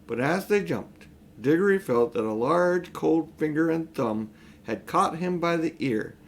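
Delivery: ordinary delivery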